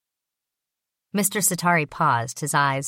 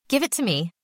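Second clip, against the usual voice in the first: higher, curt demand with a hard edge; 6 semitones higher than usual. A narrator's reading. Basil to the secretary, demanding his bag curtly. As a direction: insist coldly, no longer a child. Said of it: "the tone of his voice".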